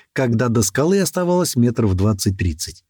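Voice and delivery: ordinary delivery, nothing marked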